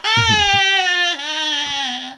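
very high, long squealing laugh; hammed-up high-pitched cackle; imitated cackle. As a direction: hold a shrill mock laugh. Imitated housewife's laugh after gossip.

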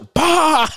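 sharp high "pah" of a defibrillator jolt; shock sound. Retold or imitated. Imitating the shock as the paddles hit the drunk man. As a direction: snap it out like an electric zap.

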